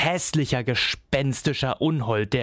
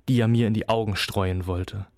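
ordinary delivery, nothing marked

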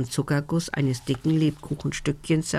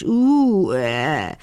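dragon's yawn; 3 dB louder than usual. A narrator's reading. The dragon opens his maw wide, yawning. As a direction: yawn loudly, swooping down and fading out. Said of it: "gähnte der Drache".

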